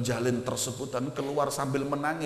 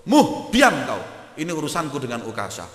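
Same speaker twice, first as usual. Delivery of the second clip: loud, firm order to be silent; stern command; 3 semitones higher, 11 dB louder than usual. Acted. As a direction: cut him off with authority, fast at the end. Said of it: "kata Rasulullah". (perintah tegas dan keras)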